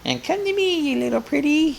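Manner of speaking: hammed-up Wizard of Oz witch; Wicked Witch voice. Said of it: "The witch goes".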